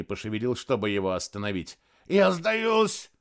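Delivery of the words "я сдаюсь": high gurgling plea through blood. A narrator's reading. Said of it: "пробулькал Тадино".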